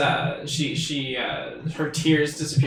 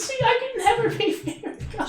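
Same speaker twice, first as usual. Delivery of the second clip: sobbing. Acted. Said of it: "starts sobbing".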